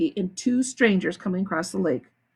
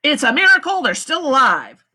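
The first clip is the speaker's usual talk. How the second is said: warned; 6 semitones higher, 7 dB louder than usual. A narrator's reading. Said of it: "Stanley's lawyer warned".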